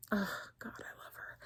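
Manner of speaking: hushed, whispered swoon; breathy, reverent whisper; adoring whisper. Real, not acted. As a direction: whisper it like a smitten fan. Gushing aside about a favourite actress.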